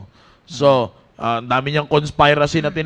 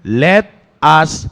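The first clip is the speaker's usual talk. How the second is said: scripture reading; 3 semitones lower, 4 dB louder, much slower than usual. Acted. Reading Ecclesiastes aloud.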